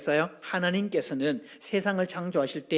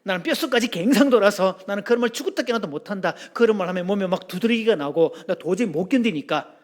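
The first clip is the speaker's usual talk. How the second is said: emphatic acted Busan dialect; hammed-up Busan husband laying down terms; Busan man's accent; 3 dB louder than usual. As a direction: protest as a stubborn Busan groom. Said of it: "어느 부산 남자가 장가를 가면서 그랬답니다".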